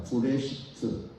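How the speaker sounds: 브레시트 said slowly and softly, syllable by syllable as pu-re-shi-t; slow, soft Hebrew 브레시트 with a falling end; Hebrew word said slowly. Shown as a demonstration. Pastor models 브레시트 softly and slowly for the congregation. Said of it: "브레시트 이렇게 합니다".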